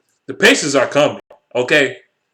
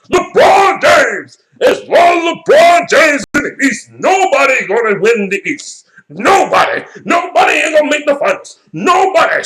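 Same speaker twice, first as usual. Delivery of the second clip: high, loud hammed-up sports pundit yelling; shouting like an overexcited ESPN host, mocking; 10 semitones higher, 5 dB louder than usual. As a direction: parody the TV hype men at full blast. Mocking ESPN pundits hyping LeBron.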